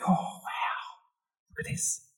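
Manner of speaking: hushed, whispered wonder; soft voiceless marvelling; awed whisper. Acted. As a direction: whisper it as if admiring fine work. Awe at the finished timber.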